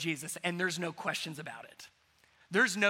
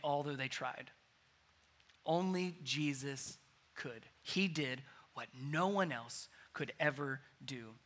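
solemn and tender; 6 semitones lower, 7 dB quieter than usual. Real, not acted. Preacher tenderly telling the congregation about Jesus' life and freedom. Solemn slow close of sermon.

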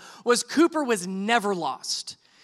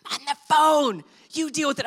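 higher-pitched imitation of an annoyed wife; mock irritated wife; 4 semitones higher than usual. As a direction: brush him off, mock annoyance. Wife snapping back on phone.

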